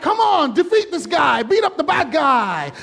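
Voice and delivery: high, eager cheering; urging the hero to beat the bad guy; excited disciples. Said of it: "It's like".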